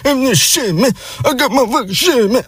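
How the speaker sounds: high-pitched slurred cartoon gibberish; unintelligible mumbler. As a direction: garble it up high, mocking a drunk-sounding guy. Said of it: "I couldn't even hear what he was saying".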